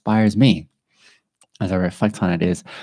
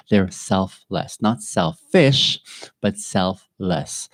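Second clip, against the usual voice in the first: measured contrast between "selfless" and "selfish"; stress contrast; much slower than usual. Shown as a demonstration. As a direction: lean on "not selfish" to set it apart. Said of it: "contrasting selfless vs selfish".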